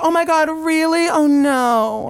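high mock dismay; hammed-up, high-pitched concern; feigned shock. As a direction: fake innocent surprise on the phone. Feigns shock to panicking friend.

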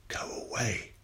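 a hushed, otherworldly voice fading up from a whisper; a haunting voice inside her head; a voice in her head, repeating go away; inner voice. A narrator's reading. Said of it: "A voice throbbed in her head".

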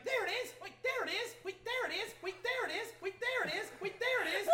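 high, looping repeated phrase like a broken record; glitching computer voice. Acted. Game master voicing the shot-up computer, high glitching loop. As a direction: stutter it like a failing machine. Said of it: "And the computer's like".

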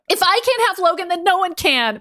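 yell. Acted. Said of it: "Mystique yelled".

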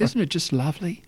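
light Cockney tea lady voice; Cockney accent. Retold or imitated. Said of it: "in her Cockney accent".

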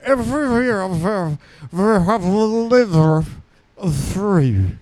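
garbled British cartoon naturalist, drawn out; Nigel Thornberry. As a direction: mangle it so listeners barely understand.

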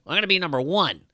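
high-pitched competitive American boast; brash American. Acted. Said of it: "That's the American way".

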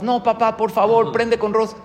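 put-on high pleading child's voice; begging little girl. Little girl pleading with her father for pink candles. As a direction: beg papa like a small child.

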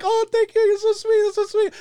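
high, loud mock gushing; squealed thanks, pitched far up; gushing young women. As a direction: imitate a group of flattered women cooing. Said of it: "They all start laughing like".

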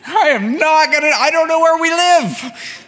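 hammed-up kid's meltdown, stretched and shrieking; retold crying child. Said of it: "and I'm crying".

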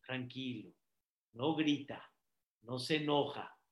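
very soft, low, calm; soft low demo of a calm temper; calm hush. Shown as a demonstration. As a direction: show calm by going quiet. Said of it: "Erech Apaim quiere decir".

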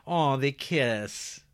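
slow, high, cooing mock swoon; sarcastic high cooing aw; mock sappy aw. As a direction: coo it like a gushing fan, drawn out. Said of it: "it's like".

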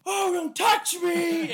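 very high, slow, hammed-up whimper; mock panicked plea. [name] begging the cloaked actor off, high mock panic. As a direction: whine it out in mock terror.

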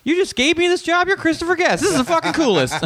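very high-pitched, giddy disbelief; retold starstruck squeal of excitement; excited. Comic thrilled at getting the director's job, excited. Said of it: "I was excited as shit".

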